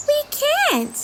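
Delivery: put-on high young fairy voice; Holly voice. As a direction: explain it as a little fairy. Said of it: "cries Holly".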